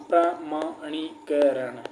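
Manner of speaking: प्रमाणीकरण drawn out syllable by syllable; slow dictation. Shown as a demonstration. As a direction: spell प्रमाणीकरण out slowly while writing it. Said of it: "मैंने प्रमाणीकरण लिख दिया ना". (प्रमाणीकरण धीरे धीरे बोलकर लिखवाना)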